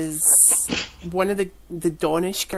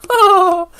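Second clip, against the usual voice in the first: loud, high imitated cackle; mock laughing; 12 semitones higher, 11 dB louder than usual. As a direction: burst out loudly, mimicking her laughter. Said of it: "laughing her ass off".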